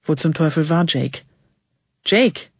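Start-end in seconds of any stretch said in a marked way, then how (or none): 2.0-2.5 short, high-pitched call. A narrator's reading. Narrator calls for Jake from the dark tomb, calling up. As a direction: call up to someone out of sight. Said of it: "rief ich nach oben".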